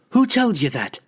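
high-pitched indignant woman's demand; outraged, high questioning; sharp demand. A narrator's reading. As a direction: demand an answer, pitch jumping up. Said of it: "Canterbury demanded".

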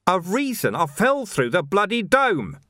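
high, indignant disbelief; exasperated outburst. Acted. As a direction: burst out in acted exasperation. Vimes explodes in exasperation.

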